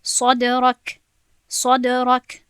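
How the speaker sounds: صدرک recited loudly with the final ک clearly sounded; released final kaf. Shown as a demonstration. Teacher showing students the last letter, final kaf clearly released. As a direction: let the ک ring out at the end of صدرک. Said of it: "دیدید که من چقدر ک گفتم در آخر".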